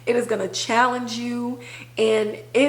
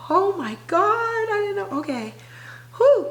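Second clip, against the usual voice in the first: fighting back real tears mid-sentence; getting emotional; 10 semitones higher than usual. Real, not acted. Host overcome talking about the show, voice breaking high. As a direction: voice cracking high as tears come, then a shaky exhale. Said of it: "Oh, I'm getting emotional".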